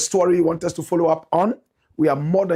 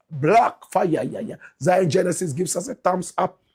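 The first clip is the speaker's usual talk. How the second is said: ordinary delivery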